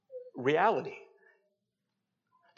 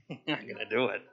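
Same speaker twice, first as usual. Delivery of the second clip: chuckling laugh; 6 semitones lower, 8 dB quieter, faster than usual. Shown as a demonstration. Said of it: "sometimes it just makes me laugh".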